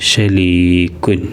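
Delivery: ഷലീക്കുൻ said slowly with the ലീ stretched long; long ee in Arabic word. Shown as a demonstration. Teacher shows pupils the long vowel in ഷലീക്കുൻ, slow and stretched. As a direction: draw out the ലീ in ഷലീക്കുൻ for the class. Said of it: "ഷലീ നീട്ടാനുണ്ട്".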